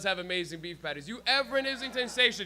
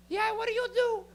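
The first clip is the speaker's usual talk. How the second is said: high, loud mom question; squeaky mock-mom inquiry; immigrant mom; 14 semitones higher, 4 dB louder than usual. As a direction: ask it as an imitated mother, pitched way up. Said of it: "I have immigrant moms".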